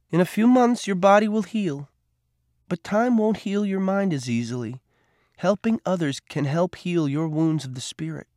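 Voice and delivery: put-on a caring nurse comforting a boy; Rosie, tender. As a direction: soothe him with kind advice.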